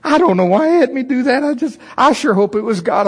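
high, sheepish excuse; mock embarrassed apology; half apologizing. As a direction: awkwardly explain away. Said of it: "half apologizing".

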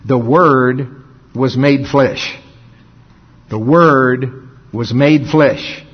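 slow, measured scripture quote; deliberate, spaced-out solemn declaration; religious recitation. Shown as a demonstration. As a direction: spell it out slowly for churchy ears. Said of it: "say it a different way for your religious head".

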